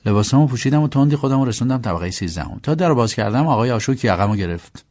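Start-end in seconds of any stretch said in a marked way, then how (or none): none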